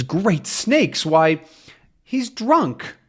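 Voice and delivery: high-pitched storybook astonishment; astonished. Acted. Tintin discovering his dog is drunk, astonished. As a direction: exclaim in acted shock.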